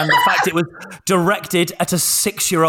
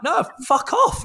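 high, slow incredulous no; acted indignation, pitched up; retold indignant refusal; 9 semitones higher, slower than usual. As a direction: refuse the mum with mock outrage.

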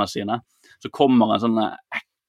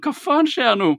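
much higher, stunned mock cry; shocked disbelief. Viewer reacting to the film's twist, high shocked disbelief. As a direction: gasp it out in horror. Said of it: "og du er sånn".

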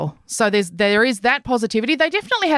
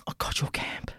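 breathy whispered tease; whispering; 13 dB quieter than usual. Retold or imitated. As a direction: whisper it like a secret.

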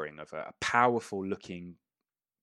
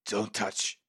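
lightly voiced lizard guard; a terse warning in creature voice; a hint of lizardfolk voice; lizardfolk character. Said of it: "just goes".

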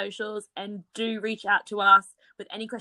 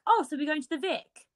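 put-on higher-pitched question in a light British accent; slipped-in British accent; 7 semitones higher than usual. Aussie asking her friends at the bar, British accent. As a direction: ask it with a British twang. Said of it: "You sounded so freaking British".

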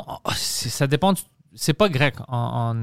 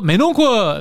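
"mais non quoi" swooping high and loud; exaggerated sung tune on "mais non quoi"; singsong French intonation; 7 semitones higher, 5 dB louder, slower than usual. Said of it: "tu chantes?".

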